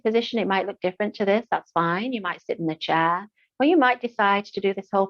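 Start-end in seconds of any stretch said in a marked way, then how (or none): none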